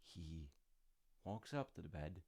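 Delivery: usual speaking voice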